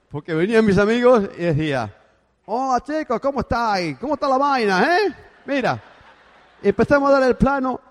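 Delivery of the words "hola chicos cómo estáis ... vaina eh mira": put-on slightly higher, lively greeting in a borrowed Cuban accent. Greeting his friends in a light Cuban accent. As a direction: greet your friends with a picked-up Cuban lilt. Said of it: "Miguel, ¿qué te pasa?".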